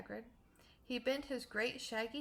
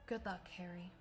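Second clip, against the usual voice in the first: soft, low farewell murmur; 3 semitones lower, 6 dB quieter than usual. A narrator's reading. Dumbledore bidding baby Harry goodbye, murmuring. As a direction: breathe it softly to the baby. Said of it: "he murmured".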